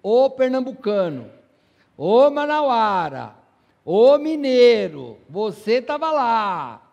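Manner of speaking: loud, drawn-out teasing call; playful calling out. Pretend. Preacher teasing the congregation: loud playful call. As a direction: call them out by their home state, slow and loud. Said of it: "Vou brincar com vocês aí".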